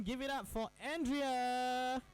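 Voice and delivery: drawn-out, high, excited host call; hype announcer intro. Real, not acted. Radio host bringing his guest on air, with a drawn-out, high, excited intro. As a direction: slowly whip up the crowd for the guest.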